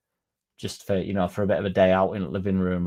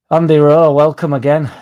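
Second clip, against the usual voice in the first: loud welcome shout; 5 semitones higher, 11 dB louder than usual. Real, not acted. Streamer shouting a welcome to a new viewer. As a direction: belt out the viewer's name, louder and higher.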